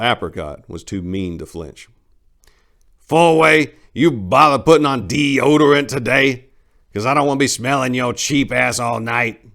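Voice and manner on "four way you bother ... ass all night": put-on loud, high, insulting old man bark. Old Apricot mocking Four-way's smell, high loud bark. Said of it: "barked Apricot".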